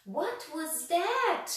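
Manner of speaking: high, loud, slow frightened shriek. A narrator's reading. Mister Jelly hears a snore, shrieking high and slow. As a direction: shriek it, drawn out in terror. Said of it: "shrieked Mister Jelly".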